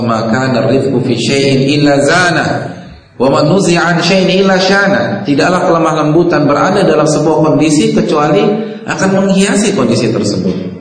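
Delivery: loud, ringing hadith recitation and translation; preacher in full flow; fervent preaching. Acted. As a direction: proclaim it to the hall.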